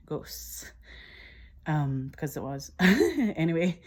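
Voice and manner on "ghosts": breathy ghost-story whisper on ghosts; whispered ghosts for a spooky effect. Acted. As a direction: hush it like a campfire tale. Spooky punchline about Scottish ghosts.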